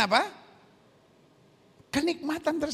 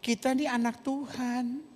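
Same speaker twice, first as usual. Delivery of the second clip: softly chiding husband, low and unhurried; soft, low, patient mock admonishment; gentle husband's reproach; 3 semitones lower, 5 dB quieter, slower than usual. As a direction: play a husband mildly correcting his wife. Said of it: "Lalu suaminya tegur".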